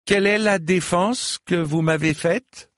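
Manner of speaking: high-pitched, commanding question; cried challenge. A narrator's reading. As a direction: throw the question out as a taunt. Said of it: "cria sa seigneurie". (défi lancé en criant)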